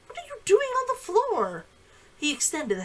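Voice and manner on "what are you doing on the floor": high, startled call of her name; confused man finding her on the floor; mild startled concern; surprised. A narrator's reading. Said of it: "in confusion and apology".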